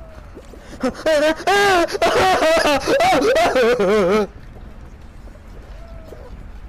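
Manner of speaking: high, wailing laughter; long peals of high laughter; big laughing fit. Real, not acted. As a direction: laugh helplessly and let it run on. Helpless laughter after mock scare.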